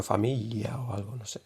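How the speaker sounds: whispered familia o algo; whispered aside. Real, not acted. Teacher whispering while searching for an example. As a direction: murmur it to yourself.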